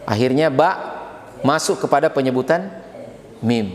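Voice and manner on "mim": usual speaking voice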